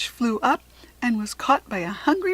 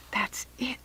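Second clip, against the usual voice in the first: soft excited whisper; 3 semitones higher, 7 dB quieter than usual. A narrator's reading. Kirsty whispers her idea into Rachel's ear. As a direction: breathe it so Jack Frost can't hear. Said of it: "she whispered".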